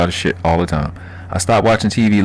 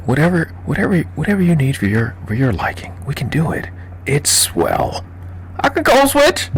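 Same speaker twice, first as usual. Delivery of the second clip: higher, polite customer-service Baltimore voice switched off; code switching; 8 semitones higher than usual. Shown as a demonstration. Streamer shows chat how he code switches, polite higher voice. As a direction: switch into a proper, eager-to-please voice, higher. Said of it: "I know how to code switch".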